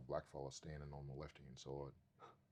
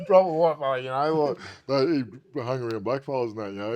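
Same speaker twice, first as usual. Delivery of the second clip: laughing loudly, higher voice; 8 semitones higher, 18 dB louder than usual. Real, not acted. Describing his red-haired mate to the host, laughing loudly.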